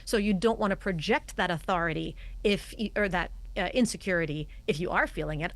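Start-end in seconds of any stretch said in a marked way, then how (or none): none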